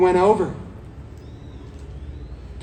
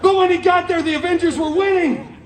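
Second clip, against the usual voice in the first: excited storytelling; 6 semitones higher, 4 dB louder than usual. A narrator's reading. Dramatic arrival at battle.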